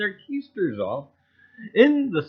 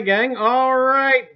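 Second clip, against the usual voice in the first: usual speaking voice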